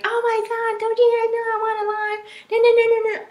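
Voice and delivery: high, loud mock tirade at the kids; imitated storming-out yell; hammed-up upset yelling. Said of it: "would have been very upset".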